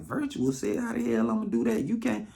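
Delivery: high, quick disbelieving put-on voice; mock incredulous woman. Woman doubting virtual sex, high incredulous voice. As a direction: squeak it out in disbelief.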